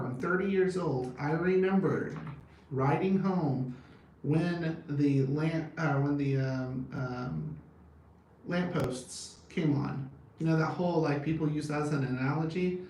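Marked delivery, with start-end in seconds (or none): none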